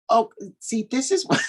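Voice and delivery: a playful take on how he talks; teasing impersonation of partner. Retold or imitated. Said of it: "gonna try my best to do your impersonation of you".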